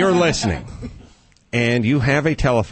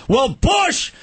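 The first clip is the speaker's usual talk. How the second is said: much higher, shouted rant; mock outraged shout; 12 semitones higher than usual. Liberals mocked ranting at Bush over the Superdome. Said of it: "all Whitey did was go".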